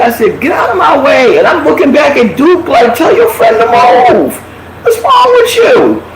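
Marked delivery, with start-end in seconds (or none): 0.4-1.4 retold demand. Confronting man blocking the door. 2.9-6.0 pleading with Duke to move his friend, shouting and near tears; upset shouted plea. Retold or imitated.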